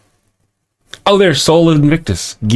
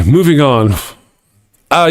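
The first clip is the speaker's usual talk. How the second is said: normal talking voice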